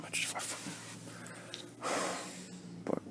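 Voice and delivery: whispered, breath only; barely breathing the words out; real whisper. Real, not acted. Admitting her anger to herself in a real whisper.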